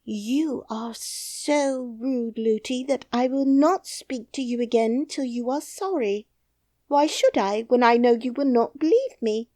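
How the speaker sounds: princess. Acted. Princess answers the rude nurse, high.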